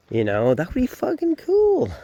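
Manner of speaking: high, thrilled burst; excited. Real, not acted. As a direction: gush about the idea. Imagining a fun park ride.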